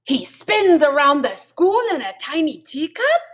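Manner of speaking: high, astonished question; shocked exclamation. A narrator's reading. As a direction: gasp in disbelief at the news. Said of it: "exclaimed the kitty boy in shock".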